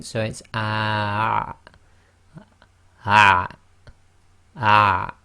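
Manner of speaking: ah squeezed off in the throat, loud; ah then block the throat tight like at the doctor's; ain sound. Shown as a demonstration. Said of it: "say ah, and then block the throat".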